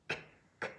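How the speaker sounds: K as a bare k click, no vowel; isolated letter sound. Shown as a demonstration. Teacher shows the sound of C, K as a short bare k. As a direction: say K as the hard consonant alone, twice. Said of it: "on dit le son que".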